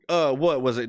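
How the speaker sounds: ordinary talk, nothing marked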